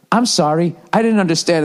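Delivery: polite robot phone voice. Retold or imitated. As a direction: say it like an automated system. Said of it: "it comes back on and goes".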